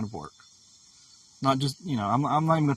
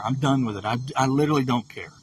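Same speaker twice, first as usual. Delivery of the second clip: ordinary talk, nothing marked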